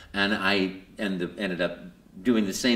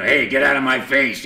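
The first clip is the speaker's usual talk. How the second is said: gruff New York plumber, much louder; grumpy tough guy; 5 semitones higher, 9 dB louder than usual. Retold or imitated. The plumber voice he rejected, a loud gruff tough guy. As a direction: shove them off, a brusque working man. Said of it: "sort of, uh, guy".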